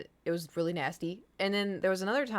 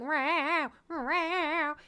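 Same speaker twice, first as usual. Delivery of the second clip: a hint of a higher cat meow; lightly imitated cat; 8 semitones higher than usual. Lightly imitating her cat screaming meows. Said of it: "he was like".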